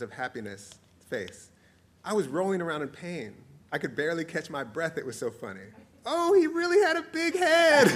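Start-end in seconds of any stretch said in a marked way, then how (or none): 6.1-7.9 very high, loud squeal; high-pitched, cracking up; gleeful punchline. Acted. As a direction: squeal it on the edge of laughter. Punchline delivered while cracking up.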